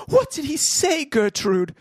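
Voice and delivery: lightly voiced concerned aunt. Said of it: "she asked".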